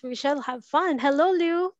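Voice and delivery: ordinary talk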